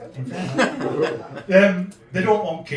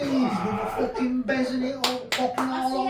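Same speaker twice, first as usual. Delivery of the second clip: band hype cry; 8 semitones higher than usual. Acted. Hailing his Manchester band.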